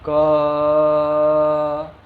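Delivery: क held long and loud so that it fades into a long अ; drawn-out demo. Shown as a demonstration. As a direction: sustain क until only the vowel अ rings. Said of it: "दोहराइए मेरे साथ".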